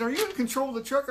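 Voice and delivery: high, rapid-fire demand; acted escalating interrogation; pressing question. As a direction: press harder, quick and high. Friend presses harder with questions.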